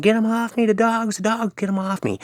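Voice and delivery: very high-pitched panicked imitation; mock screaming. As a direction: act out a man fending off imaginary dogs. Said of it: "he was, like, screaming".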